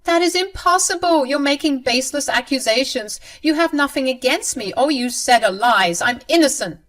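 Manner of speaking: high, loud protesting mother-in-law; shrill denial. Acted. Mother-in-law protesting innocence, high and loud.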